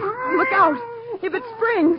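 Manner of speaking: high, loud cry of warning; frightened shout. Acted. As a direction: shriek a panicked warning. Cat about to spring.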